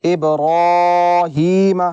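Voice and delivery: ابراہیم very slowly recited with a full ra; ابراہیم drawn out with heavy ra. Shown as a demonstration. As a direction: chant ابراہیم with a thick ra. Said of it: "را کو پر پڑھا جاتا ہے".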